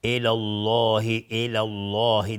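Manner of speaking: الله with a thick, dark L, slowly drawn out; heavy L. Shown as a demonstration. Teacher demonstrating after fatha, heavy L in الله.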